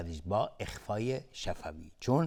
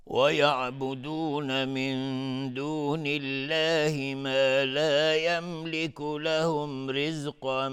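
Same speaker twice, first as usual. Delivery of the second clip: slow, drawn-out chanted recitation, raised pitch; Quran recitation; 6 semitones higher, 4 dB louder, much slower than usual. Acted. Teacher reciting the verse to his students, slow melodic tajweed chant. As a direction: intone the verse slowly, stretching each vowel.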